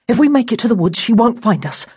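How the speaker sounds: pressed, conspiring urgency; urgent. A narrator's reading. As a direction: hurry a friend along as you plot escape. Boy hurries friend away from sister.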